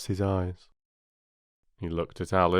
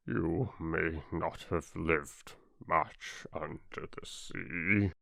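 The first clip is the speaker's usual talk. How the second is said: much softer, slow, lower, tearful; Mock Turtle to Alice, tearful and slow; 5 semitones lower, 8 dB quieter, slower than usual. A narrator's reading. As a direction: speak through tears, barely holding on. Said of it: "with tears running down his cheeks".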